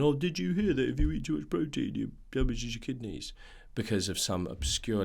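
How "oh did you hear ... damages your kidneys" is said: lightly voiced pub know-it-all; guy down the pub. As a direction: play a bloke passing on pub gossip. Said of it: "the guy down the pub going".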